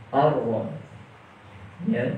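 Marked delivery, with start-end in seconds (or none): 0.1-0.7 Ar-ro said thick and heavy. Shown as a demonstration. Teacher repeating the heavy Ar-ro for students. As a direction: give Ar-ro a full heavy R. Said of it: "ditebalkan pengucapannya".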